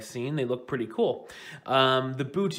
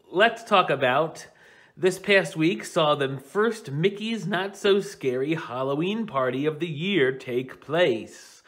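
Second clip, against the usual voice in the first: ordinary delivery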